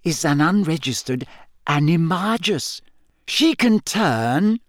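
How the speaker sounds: building, barely contained triumph; quiet triumph. A narrator's reading. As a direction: savour each step of the reveal. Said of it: "trembled with quiet triumph".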